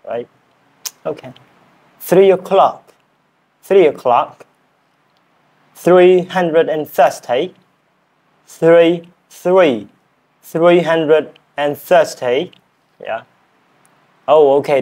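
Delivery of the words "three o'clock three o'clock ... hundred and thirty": higher, deliberate British-style drill; British accent attempt. Shown as a demonstration. Learner practising numbers, British accent attempt. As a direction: sound out each number carefully as if British. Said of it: "I am learning British accent".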